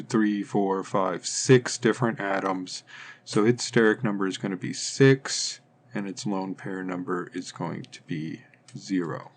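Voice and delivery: ordinary talk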